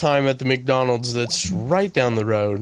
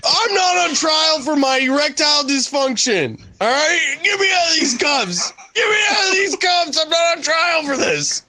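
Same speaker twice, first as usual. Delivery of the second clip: angry shouting; 10 semitones higher than usual. Acted. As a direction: yell it out, outraged and pleading. Defendant protests cuffs in mock trial.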